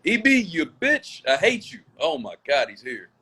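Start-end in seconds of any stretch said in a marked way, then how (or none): 0.0-1.8 yelling. Real, not acted. Shouting at a visitor.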